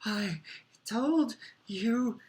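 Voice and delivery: high, drawn-out gasp of reproach. A narrator's reading. Hermione with a stitch in her chest, gasping. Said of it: "Hermione gasped".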